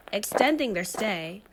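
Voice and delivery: plain reading voice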